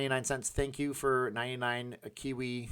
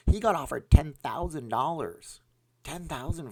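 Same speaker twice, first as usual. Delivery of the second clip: higher and louder, outraged at the sum; indignant disbelief; 7 semitones higher, 8 dB louder than usual. Real, not acted. Telling viewers about a bigger sponsor offer, loud indignant disbelief. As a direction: stress the unfair sum loudly.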